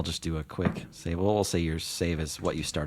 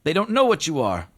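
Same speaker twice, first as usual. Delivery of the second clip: genie voice; 12 semitones higher, 9 dB louder than usual. Acted.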